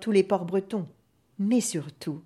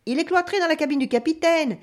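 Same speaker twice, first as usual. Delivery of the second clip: high-pitched sailor's reply; gossiping sailor; 7 semitones higher, 3 dB louder than usual. A narrator's reading. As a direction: answer brightly, voice lifted. Another sailor answers the gossip.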